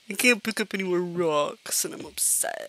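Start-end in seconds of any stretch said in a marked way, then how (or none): 1.9-2.7 whisper. Real, not acted. Mock sulk after laugh.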